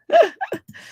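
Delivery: a sudden, very high giggle; high burst of laughter. Real, not acted. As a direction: laugh out loud at yourself. Laughing at herself over lunch.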